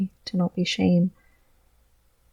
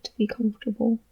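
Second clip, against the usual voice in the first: breathy whisper, half to himself; soft sincere whisper; whispered affirmation; 3 semitones higher, 6 dB quieter, faster than usual. Real, not acted. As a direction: whisper it like a private promise. Emotional vlog, sincere self-affirmation.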